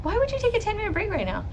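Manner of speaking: usual speaking voice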